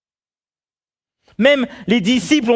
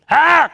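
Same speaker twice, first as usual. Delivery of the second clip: short loud high "ah"; exasperated yell; 8 semitones higher, 5 dB louder than usual. Acted. Employee whose boss says nothing, exasperated yell. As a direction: yell out your frustration in one syllable. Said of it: "le seul mot que vous, vous vous dites".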